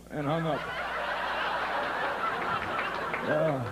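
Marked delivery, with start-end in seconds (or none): 0.6-3.2 airy laugh. Real, not acted. Comic laughing after the airline joke. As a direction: chuckle at your own joke.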